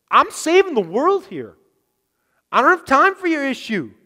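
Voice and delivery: brushing them off, hammed-up and loud; a busy man waving people away; mock impatience. Preacher playing a Jesus too busy for people, mock impatient.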